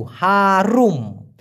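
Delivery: হারুম drawn out very slowly with a clear উ, not আ; careful pronunciation. Shown as a demonstration. Teacher to viewers correcting হারাম to Malay হারুম, very slow. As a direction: stretch হারুম slowly, keeping the উ sound. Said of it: "হারাম না কিন্তু".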